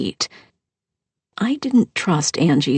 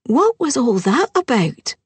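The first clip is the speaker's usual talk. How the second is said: put-on higher, puzzled young bride; lightly voiced bride; 8 semitones higher than usual. Bride asking what just happened, higher. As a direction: ask it up high, curious.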